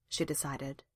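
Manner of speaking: ordinary delivery, nothing marked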